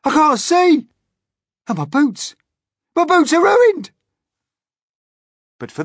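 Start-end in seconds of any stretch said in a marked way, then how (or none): 0.0-0.9 very high, loud wail of outrage; angry scream. Acted. Centipede blinded by paint, screaming with anger. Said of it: "The centipede screamed with anger". 1.7-3.9 high outraged cry, building louder; angry screaming. Acted. Centipede laments ruined boots, screaming with anger. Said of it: "The centipede screamed with anger".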